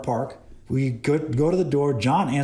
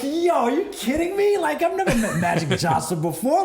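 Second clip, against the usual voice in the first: higher, laughing disbelief; shocked disbelief; 13 semitones higher than usual. Retold or imitated. Cameraman recalls his stunned reply to Magic Johnson, high laughing disbelief. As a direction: act stunned and amused, pitched up.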